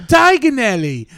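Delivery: high, loud Harry Potter British; hammed-up British wizard accent. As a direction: proclaim it in mock British. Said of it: "I watch a lot of Harry Potter".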